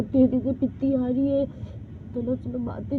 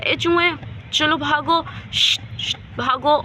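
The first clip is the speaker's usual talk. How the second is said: louder shooing with hissed shh; 6 dB louder than usual. Acted. Abdul shoos the mouse away with a stick, loud.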